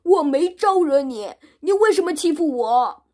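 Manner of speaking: loud, high indignant yell; angry shout. Acted. Monster protesting to Wukong, loud and indignant. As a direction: shout back in outrage. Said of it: "妖怪跳出来喊道".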